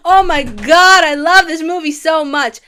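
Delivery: loud, high excitement; squealing fangirl delight; gushing. Real, not acted. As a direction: burst with love for it. Gushing about the film.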